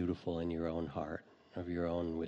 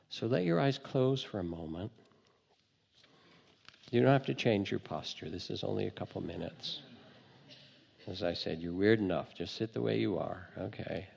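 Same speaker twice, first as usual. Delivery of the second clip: guided meditation. Acted. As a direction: calmly lead listeners inward.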